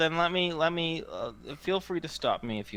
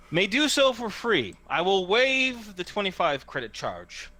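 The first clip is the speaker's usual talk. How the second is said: hawker's generous offer; 6 semitones higher than usual. Acted. Hawker waives the charge for the first taker, showman's patter. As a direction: make the grand offer with swooping, punched words. Game master voices hawker.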